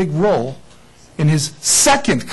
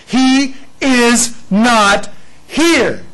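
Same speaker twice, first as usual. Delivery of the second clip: "He is not here" stretched out slowly, raised and loud; slow proclamation; 8 semitones higher, 6 dB louder, much slower than usual. Shown as a demonstration. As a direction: draw out each word of "He is not here". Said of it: "And I love this".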